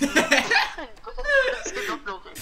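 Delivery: high laughing words, squealed out. Real, not acted. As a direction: laugh through the line. Rire en lisant la question. (en riant aux éclats)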